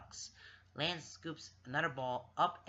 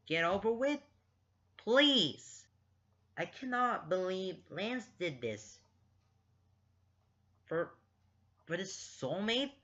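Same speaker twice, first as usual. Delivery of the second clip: worked-up rant; 5 semitones higher, 4 dB louder than usual. Real, not acted. Reader berates Lance over his soulmate, worked up. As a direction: plead and scold in disbelief. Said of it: "I just need to calm down".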